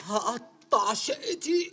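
dazed slow repeat. Acted. Guest repeats slowly, dazed.

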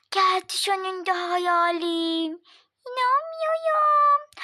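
high gasp, then slow disbelief; amazed gasp. Acted. Child gasps at her dream cake finally arriving, amazed.

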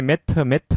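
매트 in two syllables with a final 으 vowel; Korean-style pronunciation. Shown as a demonstration. Teacher showing learners what to avoid, 매트 with an added final vowel. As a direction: say 매트 as a Korean loanword, not English mat. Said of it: "매트를 하지 마시고".

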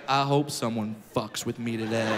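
low, cool mock swagger. Cocky kid walking into kindergarten, swaggering. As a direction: murmur it low, itching for a challenge. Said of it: "with some swag, like".

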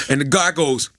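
ordinary talk, nothing marked

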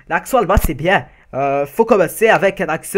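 loud caricatured German accent; French spoken with a booming mock-German accent. Shown as a demonstration. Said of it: "euh, sinon allemand".

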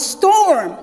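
a loud, high call of Storm. Shown as a demonstration. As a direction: boom out Storm like thunder. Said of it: "you have to say it loud".

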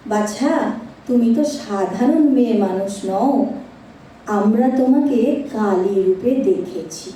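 ordinary delivery

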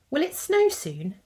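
put-on high, eager little-girl voice; high child voice full of hope; little Seren. A narrator's reading. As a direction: ask it like a hopeful small child. Child character Seren asks.

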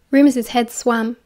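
usual speaking voice

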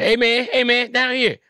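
high, whiny little rat voice; be a tiny rat with a sore back; high complaining lab rat; talking rat. Pretend. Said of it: "Can you imagine these humanized rats?".

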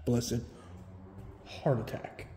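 drops to a whisper after a scare; breathless whisper, heart still racing; hushed startle. Real, not acted. Startled by the cat.

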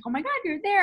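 high-pitched imitated squeal of excitement; excited friend. Best friend calling the newly arrived traveler, excited. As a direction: gush like a thrilled best friend.

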